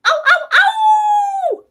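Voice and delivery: very high, loud, long wolf howl; playful wolf howl. Pretend. Co-host greeting Wolfie in the chat, playful wolf howl.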